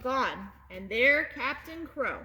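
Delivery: ordinary delivery, nothing marked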